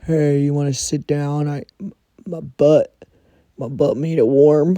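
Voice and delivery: mocking the coworker who offers weed and a warm seat; imitating coworker. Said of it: "He always is like".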